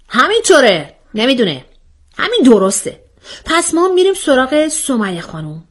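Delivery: high, brisk confirming voice; mock-official questioner. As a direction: rule on it like a self-appointed judge. Acts out the questioning character.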